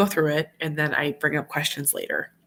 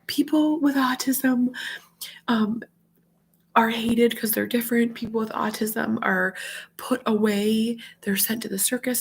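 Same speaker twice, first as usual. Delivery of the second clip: imitating tearful son; 6 semitones higher than usual. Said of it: "he's crying and he's like".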